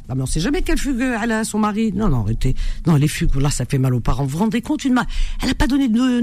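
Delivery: ordinary talk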